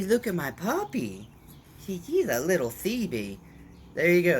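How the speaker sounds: cooing at puppy. Real, not acted. Showing off his puppy to viewers, high gushing baby talk. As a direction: gush over the puppy in a sing-song voice.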